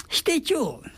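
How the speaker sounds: high-pitched Nagoya してちょう; Nagoya dialect phrase. Shown as a demonstration. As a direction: lift してちょう well up in pitch. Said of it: "っていうのは".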